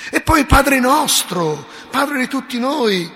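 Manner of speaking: high, loud and slow; rising fervent proclamation. Real, not acted. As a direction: lift it with fervor. Preacher moved by the prayer.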